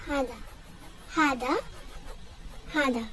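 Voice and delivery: "Hada" repeated clearly as HA-da; pronunciation demo. Shown as a demonstration. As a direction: model "Hada" with pauses between repeats. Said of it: "teach you how to pronounce it".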